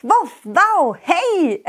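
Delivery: high goofy dog puppet voice; goofy muffled sock puppet. Acted. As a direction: bark it like a silly puppet dog.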